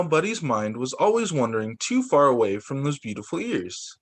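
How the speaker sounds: plain reading voice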